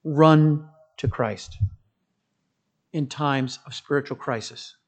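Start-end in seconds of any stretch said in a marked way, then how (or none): none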